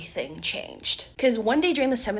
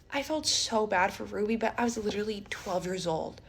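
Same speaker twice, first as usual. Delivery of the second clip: usual speaking voice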